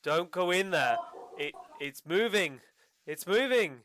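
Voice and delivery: a prisoner losing control, building to a cry; frantic panic. Acted. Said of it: "he says".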